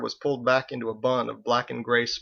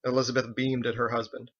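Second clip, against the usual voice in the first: ordinary delivery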